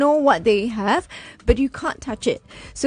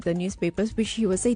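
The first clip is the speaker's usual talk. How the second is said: usual speaking voice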